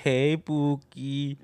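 slow, drawn-out imitation of a teasing hello; teasing pet-name call. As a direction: stretch out the greeting, playfully imitated. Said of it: "he calls him Pookie".

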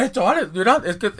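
mock pushy pitch. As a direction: ham up the eager flyer guy calling out. Acting out Carlos pitching stickers.

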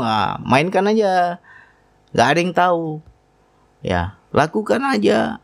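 tempting inner voice. Retold or imitated. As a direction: coax like a tempter, lightly acted.

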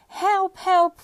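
high, loud cry for help; yelled. A narrator's reading. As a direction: yell out urgently for help. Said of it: "Puss yelled as he ran".

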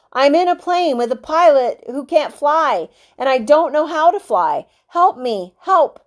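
desperate plea. A narrator's reading. Boy tells the radio operator he can't fly, desperate plea. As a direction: beg for help, voice rising.

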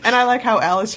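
ordinary delivery

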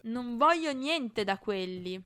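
loud, slow furious yell; angry shout. A narrator's reading. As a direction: shout the refusal. Not still yelling furiously. (urlo rabbioso e lento)

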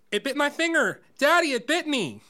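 put-on high, loud child's cry for daddy; screaming. Stepbrother in kiddie pool to Daddy, screaming. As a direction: shriek it out as if just bitten. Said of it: "he screams".

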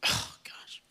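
breathless whispered oh gosh; teary whisper. Real, not acted. Pastor fighting tears before his church, whispered. Pastor crying mid-sermon.

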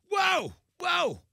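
high, loud halting cry; mock alarmed shout. Host stopping a reporter at his welcome mat, mock alarmed shout. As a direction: call out sharply to stop someone in their tracks.

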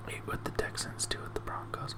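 whispered to himself; whispering. Real, not acted. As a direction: whisper it while checking notes. Checking dates under breath.

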